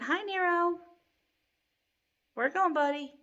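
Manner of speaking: high sing-song talk to the cat; pet talk. Real, not acted. Greeting Nero wandering past the camera, cooing. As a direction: coo at the pet.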